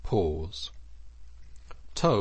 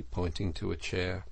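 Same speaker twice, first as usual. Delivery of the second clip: plain reading voice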